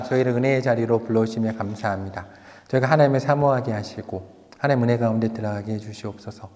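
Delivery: low, even praying voice; closing prayer. Real, not acted. Preacher praying to God for the congregation, low and even.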